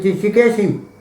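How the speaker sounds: farmer calling out. Retold or imitated. Farmer calls out at the door.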